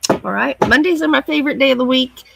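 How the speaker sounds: ordinary talk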